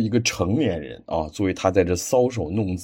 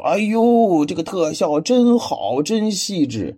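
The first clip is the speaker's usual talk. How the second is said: a higher, gushing "哎呦" then slower raving; mock gushing praise; 7 semitones higher, slower than usual. Critic mocking viewers gushing over the effects. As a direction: play an overexcited fan raving. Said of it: "很多人就在吹".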